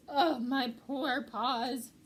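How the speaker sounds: slow, loud mock wail; hammed-up lament. A narrator's reading. Pack rat moaning over pricked paws, slow mock wail. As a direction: moan over sore paws, drawn out. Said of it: "wringing his paws and crying".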